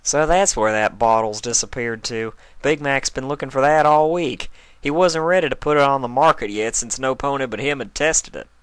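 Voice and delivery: lightly voiced country pony; Applejack voice. A narrator's reading.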